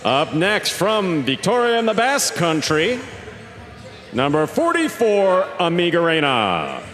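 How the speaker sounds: big ring-announcer delivery, high-pitched with drawn-out names; arena announcer. Acted. Announcer introducing the teams to the fans, booming showman delivery. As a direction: stretch each player's name out like a proud arena announcer.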